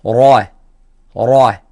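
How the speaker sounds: heavy, full-mouthed "را"; tafkhim ra. Shown as a demonstration. Tajweed teacher showing the sound of the heavy ra, emphatic demonstration. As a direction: say "را" with a thick, rounded R filling the mouth. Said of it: "الراء المفخمة صوتها".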